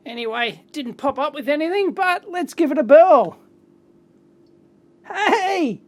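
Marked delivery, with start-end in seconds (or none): 5.1-5.8 high, delighted "hey"; delighted cheer. Real, not acted. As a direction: cheer as it springs to life. Delighted as the gadget works.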